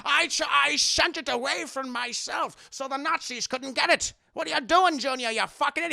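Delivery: loud, high scolding father with slushy s; Sean Connery. Retold or imitated. Father berates Junior over diary, angry impression. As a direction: scold hotly, s turning to sh.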